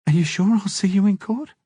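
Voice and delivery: needing reassurance; anxious Mimi. A narrator's reading.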